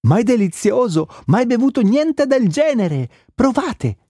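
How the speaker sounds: very high-pitched, thrilled exclamations; delighted. A narrator's reading. Cotte tastes the juice and urges everyone, high-pitched delight. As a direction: rave about it in a high, excited voice.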